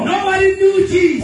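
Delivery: high, loud preacher's proclamation; full-force preaching. Real, not acted. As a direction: declare it forcefully to the congregation.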